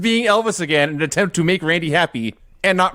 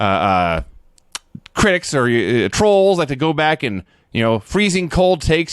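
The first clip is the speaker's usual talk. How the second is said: ordinary talk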